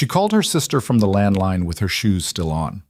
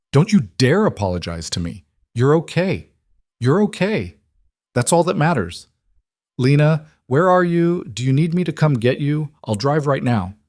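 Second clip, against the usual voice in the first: tearful; 6 semitones higher than usual. A narrator's reading. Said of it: "Maya was crying".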